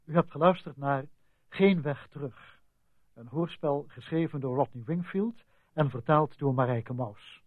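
usual speaking voice

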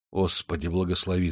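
low, soft plea to God; hushed prayer. A narrator's reading. Miner prays before facing the bodyguard, hushed. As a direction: murmur a short blessing to yourself.

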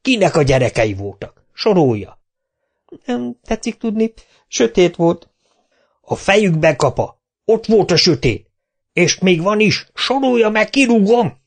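harsh, rising demands; mayor shouting at Kapa in the dark; angry boss. A narrator's reading. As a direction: hammer them with questions. Mayor interrogating and threatening.

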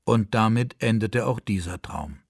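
plain reading voice

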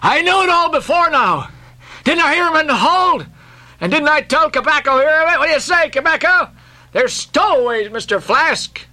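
high-pitched, eager voice; excited protest. A narrator's reading. Archie telling Flask about stowaways, excited.

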